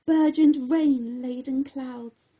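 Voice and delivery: ordinary delivery, nothing marked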